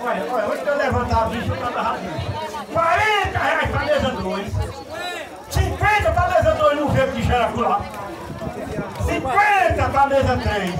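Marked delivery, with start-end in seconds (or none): 2.7-10.8 higher, ringing bid calls; auctioneer call. Real, not acted. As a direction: call out the new bid to the tables. Auctioneer raising bids.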